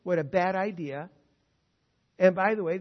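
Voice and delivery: usual speaking voice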